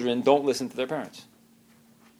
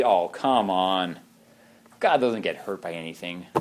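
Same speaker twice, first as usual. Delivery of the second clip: slow, lower scoffing oh come on; dismissive scoff; 6 semitones lower than usual. Retold or imitated. Preacher voicing a skeptic, dismissive and slow. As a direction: brush it off like a skeptic.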